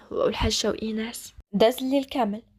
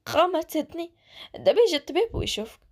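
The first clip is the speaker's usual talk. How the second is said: higher, a touch of worry while calming her; mildly fearful; 6 semitones higher than usual. A narrator's reading. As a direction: soothe her through your own fear. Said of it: "بدر بخوف قال لها". (بخوف خفيف وهو كيهدنها)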